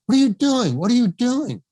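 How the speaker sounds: high, loud, repeated rebuke; John Huston's sharp challenge. Retold or imitated. Director's first words on seeing the actor steered, sharp loud challenge. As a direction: pounce on it, indignant and high. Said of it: "words out of John Huston's mouth".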